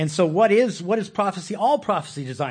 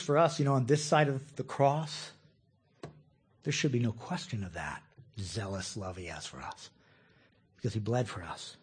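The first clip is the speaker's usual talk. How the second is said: ordinary delivery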